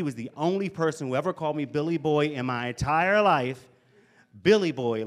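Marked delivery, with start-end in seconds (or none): 4.4-5.1 mocking. Mocking the nickname.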